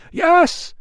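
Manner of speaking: loud high cheer; crowd shout. Acted. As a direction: shout back yes.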